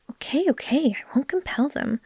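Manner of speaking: a hint of a sheepish retreat; flustered backing down. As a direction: quickly give in after the punch. Backing down after being punched.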